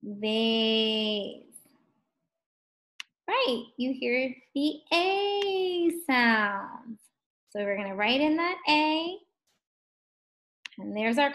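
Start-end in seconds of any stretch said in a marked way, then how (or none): 0.0-1.4 VASE with a long level vowel; said slowly. Shown as a demonstration. Teacher asking what they hear, VASE said slowly. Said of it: "Say it slow". 4.6-7.0 A held long in "the A sound"; stretched vowel. Shown as a demonstration. Teacher confirming what pupils heard, A stretched out.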